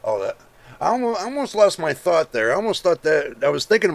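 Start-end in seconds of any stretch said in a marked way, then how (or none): none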